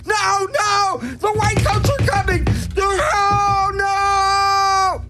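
very high squeaky puppet voice, fast and frantic; frantic Chickster. Acted. The Chickster character raving he's insane. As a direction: shriek it like a crazed cartoon chick.

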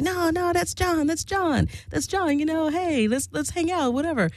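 high-pitched, light, insistent imitation; giddy husband. Husband waving off his wife's warning about John, giddy. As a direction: mimic an over-friendly husband brushing off a warning. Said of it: "there he is, Mister Giddy".

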